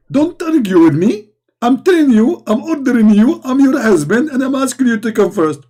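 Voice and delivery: loud, high, bossy ordering; acted high-pitched domestic shouting; screaming husband. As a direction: yell orders like a husband losing an argument. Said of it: "scream at me".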